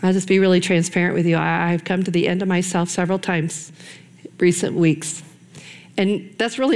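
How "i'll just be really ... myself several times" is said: ordinary delivery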